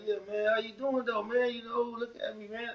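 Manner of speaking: high, teasing, fake-polite; mock greeting. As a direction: needle him with fake friendliness. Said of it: "I clowned him".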